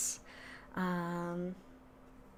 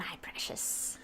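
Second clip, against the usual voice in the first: Gollum whisper; 13 dB quieter than usual. Acted. Gollum line about the straw.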